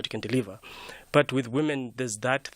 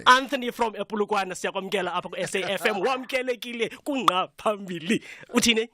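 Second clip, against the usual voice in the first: Umhlobo Wenene accent; 9 semitones higher than usual. Shown as a demonstration. Guest introduces caller Anthony on request, high Umhlobo Wenene accent. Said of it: "your best Umhlobo Wenene accent".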